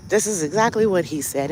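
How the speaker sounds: plain reading voice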